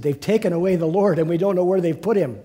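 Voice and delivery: a frantic woman raising her voice; Mary crying out. Acted. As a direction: blurt it out in panic to the disciples. Mary cries out to disciples.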